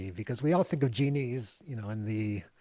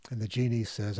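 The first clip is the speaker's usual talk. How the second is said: normal talking voice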